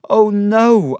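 loud, high cry of protest. A narrator's reading. David refuses his dying wife's suggestion, crying out. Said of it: "I cried".

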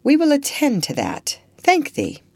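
grateful old lady, voiced high and gentle; light old lady voice. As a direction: lift into a kind old lady's voice. Old lady thanks him.

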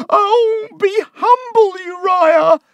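very high, loud, pleading wail; frightened cry. A narrator's reading. Mother pleading with her son Uriah, panicked high cry. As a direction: beg him in a panicked shriek. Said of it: "Missus Heap cried".

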